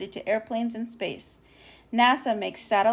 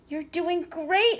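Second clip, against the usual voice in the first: slow, higher, gentle cheering on; encouraging; 7 semitones higher, slower than usual. Acted. Moon cheering on the first airplane, slow and encouraging. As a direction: coax the little plane upward. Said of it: "encouraged the moon".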